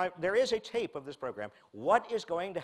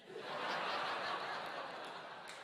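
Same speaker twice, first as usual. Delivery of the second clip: breathy laugh; 9 dB quieter than usual. Real, not acted. Laugh after punchline.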